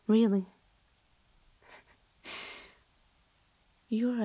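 a fed-up friend pushing someone away, often hushed; cold rejecting friend. Acted. To the clingy admirer who won't leave, cold and irritated.

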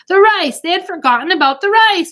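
high, loud cry of panic; alarmed. Acted. Cooks realise they forgot the rice, alarmed. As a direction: blurt it in sudden alarm.

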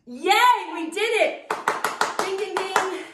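high, loud cheer. Acted. Instructor cheering with the class after the last set, excited. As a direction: celebrate the win.

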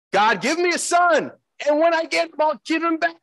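a mild hint of pleading Hannah; pleading prayer. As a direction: pitch it up like a desperate bargain with God.

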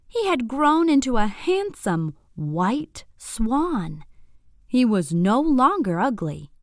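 usual speaking voice

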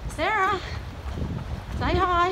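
high, bright call to a friend. Real, not acted. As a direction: call her name across the room. Calling to Sarah.

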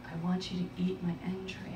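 low, hushed and quick; mock intimate request. Lover grabbing her face, hushed and intimate. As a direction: confide a kink softly, deadpan.

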